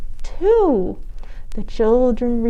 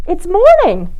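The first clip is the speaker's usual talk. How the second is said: put-on high, louder child's voice; child announcing; 9 semitones higher, 7 dB louder than usual. A narrator's reading. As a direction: break the news brightly. Patrick correcting Granny.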